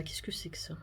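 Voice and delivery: curious whisper. Real, not acted. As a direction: whisper the question to yourself. Opening the album, curious.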